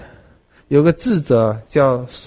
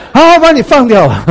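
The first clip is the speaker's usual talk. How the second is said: king relents; 10 semitones higher, 10 dB louder than usual. Retold or imitated.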